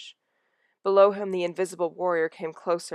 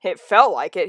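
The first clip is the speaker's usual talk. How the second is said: grumbled; 9 dB louder than usual. Acted. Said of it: "he grumbled".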